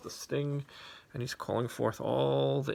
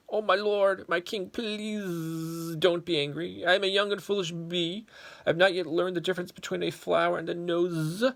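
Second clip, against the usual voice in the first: pleading little bee; 7 semitones higher, 7 dB louder than usual. Acted. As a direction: beg the angry king for mercy. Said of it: "it pleaded".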